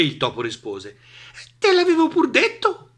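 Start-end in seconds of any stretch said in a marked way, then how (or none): 1.6-2.9 high, piping I-told-you-so; smug mouse. Acted. Mouse proved right to the monster, smug. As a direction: say it smugly, high up.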